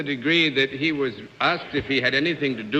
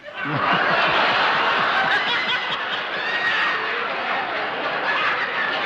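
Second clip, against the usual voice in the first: big breathy burst of laughter; hearty laugh. Real, not acted. Host laughs at panelist's joke.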